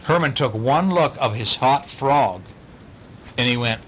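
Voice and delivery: ordinary delivery, nothing marked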